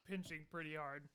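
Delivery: ordinary delivery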